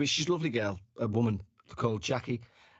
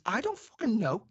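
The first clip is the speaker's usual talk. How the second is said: higher, rattled mock panic; panicked retort; 10 semitones higher than usual. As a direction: blurt it out in a fright. Panicked reply to coworker.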